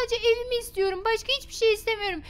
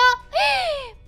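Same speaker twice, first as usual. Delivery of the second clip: shocked gasp; 6 semitones higher than usual. Acted. Mother finds paint on walls.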